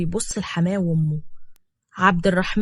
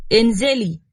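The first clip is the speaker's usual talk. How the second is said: a sharp, louder command to get out; harsh order; 2 semitones higher, 4 dB louder, slower than usual. Acted. Hossam orders the woman out of the car, harsh. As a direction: order her out coldly. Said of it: "وقال بقسوة".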